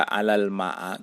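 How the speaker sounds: "alal ma'a" with the final vowel turned to a; wrong a ending. Shown as a demonstration. Teacher showing students the misreading, "alal ma'a" ending in a. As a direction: end "alal ma'a" on a instead of i. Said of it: "atau tidak dibaca".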